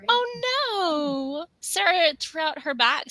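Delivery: sudden high oh no; dismayed. Real, not acted. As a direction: gasp it out in dismay. Reacting to bad news in chat.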